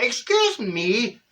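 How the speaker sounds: slow, drawn-out scandalised excuse me; mock outrage. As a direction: act shocked and prim.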